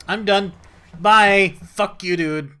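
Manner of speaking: loud, high storming-off yell; fed-up outburst. Real, not acted. Gamer storming away from the librarian, loud fed-up yell. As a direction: snap and storm off.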